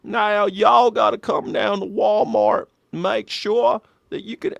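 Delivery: slow, high-pitched Arkansas drawl; mock Southern politician with an Arkansas twang; Bill Clinton. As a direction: stretch it out in a put-on Arkansas accent. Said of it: "soft Arkansas accent, Bill Clinton".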